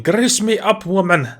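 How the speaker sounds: high mock battle yell; Scottish shout. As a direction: squeal it like a drunk brawler. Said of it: "he's screaming".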